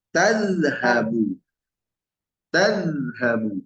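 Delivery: slow Tazhabu with the opening ta stressed; careful Arabic model word. Shown as a demonstration. Teacher repeats Tazhabu slowly for a student. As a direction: draw out Tazhabu for the learner. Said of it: "iyanya diubah menjadi ta".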